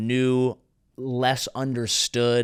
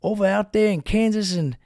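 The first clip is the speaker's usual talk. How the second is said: gullible dude; 6 semitones higher than usual. Retold or imitated. Voicing gullible guy online.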